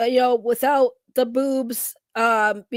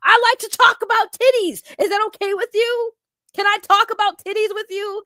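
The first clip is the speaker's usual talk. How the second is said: high-pitched, excited challenge; loud and excited; 6 semitones higher, 3 dB louder than usual. Real, not acted. Streamer teasing a viewer over the topic, loudly excited. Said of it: "I'm just loud and excited".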